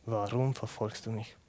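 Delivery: low, hushed, searching question; quiet voice of Jesus. Acted. Jesus asks Saulus why he persecutes him, low and hushed. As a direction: let the repeat fade almost to nothing. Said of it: "stellt eine ganz unangenehme Frage".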